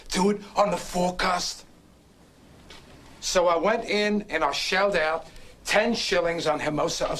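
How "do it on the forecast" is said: quoting the tout. Retold or imitated. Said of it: "he said".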